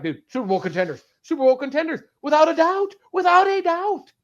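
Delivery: building imagined fan hype; hyped. Retold or imitated. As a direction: pump it up like a fan sold on the team. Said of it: "They'd be like".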